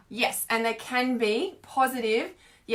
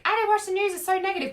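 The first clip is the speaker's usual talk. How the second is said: high, sing-song mock of a smug viewer; mocking people; 8 semitones higher, faster than usual. Mocking people who claim they skip the news, high sing-song voice. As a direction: lift the pitch and parody their smugness. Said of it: "so many people are like".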